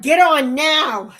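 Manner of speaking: loud, drawn-out mock command; retold urgent order. Streamer retells telling friend to get on, urgent order. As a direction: order them on, loudly and slowly.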